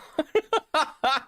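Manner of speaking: high, helpless laugh; burst of laughter. Real, not acted. Streamer amused by park bench VTuber idea, high laughter. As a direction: crack up in a high-pitched laugh.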